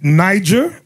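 "Niger" as NY-jer; alternative pronunciation. Shown as a demonstration. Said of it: "if you wanna pronounce it that way".

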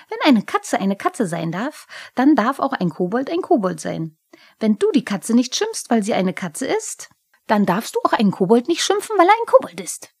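high, loud, insistent little kobold; cheeky indignant reasoning; argumentative kobold. A narrator's reading. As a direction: press the argument, rising to a squeal at the end.

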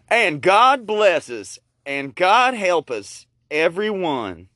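slow, loud high proclamation; hammed-up blessing. Storyteller blessing everyone, slow and hammed-up. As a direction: proclaim it like a Christmas toast.

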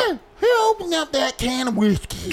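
high-pitched mock visitor; Kenny. Co-host imitating their friend hearing the bottle open, high-pitched. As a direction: pipe up high like a nosy latecomer. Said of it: "He's gonna be all".

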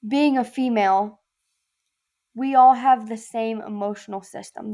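ordinary talk, nothing marked

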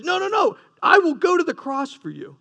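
retold vow, pushed up high and emphatic; insistent protest. Peter protests to Jesus that he will stay loyal, insistent.

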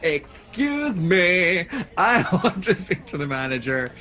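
loud, slow indignant demand; entitled customer. Pretend. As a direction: Karen demanding the manager, laughing. Acted Karen demand in restaurant.

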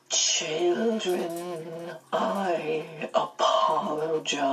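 very slow, drawn-out otherworldly voice; Missus Witch. A narrator's reading. Missus Witch apologizing to the children, in a slow, drawn-out voice. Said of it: "came Missus Witch's voice".

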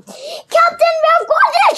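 high, loud shout to the captain; Pinky shouting. A narrator's reading. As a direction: call out the news at full voice. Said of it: "shouted Pinky".

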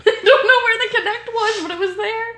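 laughing through the words; giggling while answering. Real, not acted.

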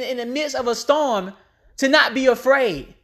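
ordinary talk, nothing marked

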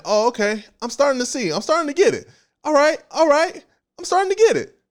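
growing realization. Acted. Preacher voicing the soul as it finally understands. Soul voiced, slowly catching on.

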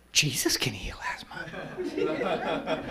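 slow laughing whisper; slow hushed chuckle of a thought. Retold or imitated. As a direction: breathe it as a private amused realisation. Inner thought, amused.

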